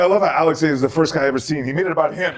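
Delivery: high-pitched mock complaint to the crowd; Colin Quinn. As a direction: imitate a comic ribbing the host. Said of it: "and he comes up and he goes".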